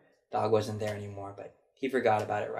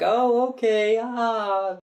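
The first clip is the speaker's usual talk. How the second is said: higher, louder mock "oh okay"; mock realization; 12 semitones higher, 8 dB louder, much slower than usual. Teasing the goth guy with a mock surprised okay. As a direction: fake sudden understanding. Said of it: "And I was like".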